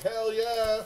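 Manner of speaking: high, punchy hell yeah. Retold or imitated. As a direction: fire it back like a cool rapper. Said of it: "if Tone Loc was like".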